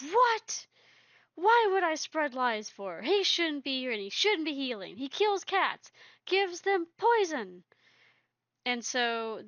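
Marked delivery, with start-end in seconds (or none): 0.0-7.6 high, loud furious screech. Retold or imitated. Quickfeather screeches accusations at Stormfang, high and loud. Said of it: "Quickfeather screeched".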